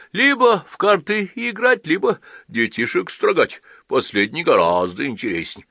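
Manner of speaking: joking priest. A narrator's reading. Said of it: "смеялся Чекамасов".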